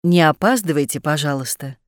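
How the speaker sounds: ordinary talk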